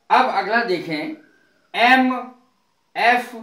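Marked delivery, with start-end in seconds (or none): none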